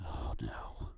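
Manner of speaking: breathy whispered aside; whispering. Real, not acted. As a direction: drop to a whisper. Aside after editor mention.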